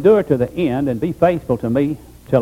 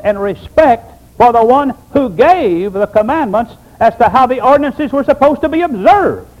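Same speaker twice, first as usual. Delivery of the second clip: preaching at full force; 7 semitones higher, 4 dB louder than usual. Real, not acted. Preacher pressing the congregation, rising to a high peak. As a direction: build the point to a peak.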